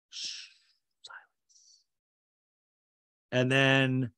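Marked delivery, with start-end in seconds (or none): none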